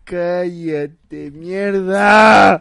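loud furious yell of mierda; angry shout. Acted. Mother yelling at her nagging son. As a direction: blast the insult at him.

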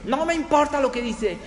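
high, loud guest tirade; retold angry shouting. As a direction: shout the receptionist down. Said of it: "le empezó a gritar".

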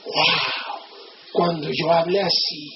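low, hushed mock awe; awed admirer. Listener dazzled by a great speaker, hushed mock wonder. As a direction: marvel softly, dropping the pitch right down. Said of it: "Llega uno que habla bien machín y dices".

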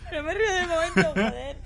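laughing through the words; giggling, half apologetic; trying not to laugh. Real, not acted. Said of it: "no seas mala persona".

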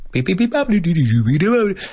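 high lilting nonsense syllables; sing-song babble. Shown as a demonstration. Teacher illustrating sonsonete, high sing-song babble. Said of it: "que suena como sonsonete".

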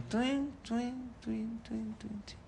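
tiny high, very soft "tuin" sounds; imitated falling twinkle. Storyteller imitating a paper falling from heaven, soft high twinkling. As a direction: chime softly in a high little voice.

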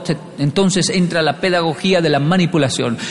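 ordinary delivery

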